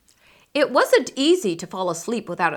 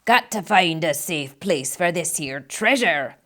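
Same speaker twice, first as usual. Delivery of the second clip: pirate captain; 2 semitones lower than usual. A narrator's reading.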